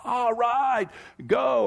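high-pitched mock game cheer; imitated fan yell. Somebody yelling at the football game, imitated cheer. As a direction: cheer high without real volume. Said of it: "Somebody's yelling".

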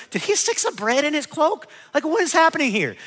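high, incredulous questioning; baffled disciples. Retold or imitated.

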